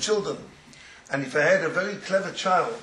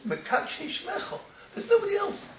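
ecstatic exclaim; 11 semitones higher than usual. Real, not acted.

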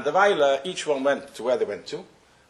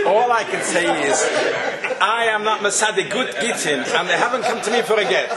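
laughing; 4 semitones higher, 6 dB louder than usual. Real, not acted. Laughing joke about the get.

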